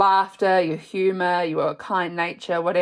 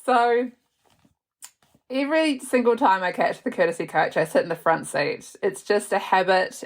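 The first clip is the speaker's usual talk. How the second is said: ordinary talk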